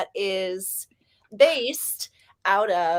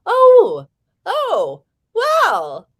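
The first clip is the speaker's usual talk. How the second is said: high, loud surprised exclamations; amazed reaction; 10 semitones higher, 9 dB louder, much slower than usual. Real, not acted. Streamer reacting to the chat, amazed high exclamations. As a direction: let out high bursts of amazement.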